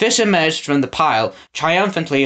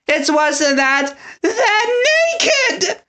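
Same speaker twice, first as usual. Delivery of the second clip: horrified cry; much slower than usual. A narrator's reading. Another townsperson, scandalised shriek. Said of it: "cried another".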